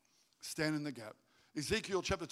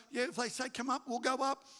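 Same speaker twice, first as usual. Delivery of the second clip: high-pitched, animated quote; eager mock explanation; 10 semitones higher, 5 dB louder than usual. Preacher playing Jonathan explaining his plan, eager mock explanation.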